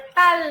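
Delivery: tal with a loud, thick dark L; tal, thick L ending, punched out; thick final L. Shown as a demonstration. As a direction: stress the dark L closing tal. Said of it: "bunyi dia akan lebih tebal".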